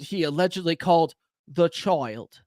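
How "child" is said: put-on light British accent on the word child; a quick stab at a British accent for child; Russell Brand British accent. Streamer quoting Russell Brand to viewers, lightly British accent. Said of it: "my Russell Brand British accent".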